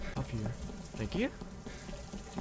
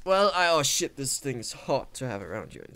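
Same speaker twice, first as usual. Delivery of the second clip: ordinary talk, nothing marked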